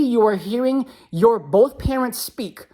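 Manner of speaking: ordinary talk, nothing marked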